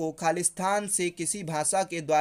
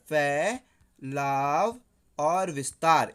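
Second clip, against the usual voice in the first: very slow, drawn-out syllables in a lower pitch; slow dictation; 5 semitones lower, much slower than usual. Shown as a demonstration. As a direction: dictate it syllable by syllable for students to note. Teacher dictates answer for memorising. (धीरे-धीरे खींचकर लिखवाने का लहजा)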